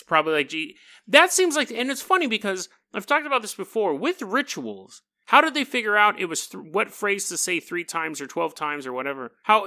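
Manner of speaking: normal talking voice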